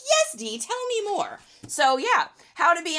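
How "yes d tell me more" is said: much higher, eager mock-fan plea. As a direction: play the hooked viewer begging for more. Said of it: "And you're like".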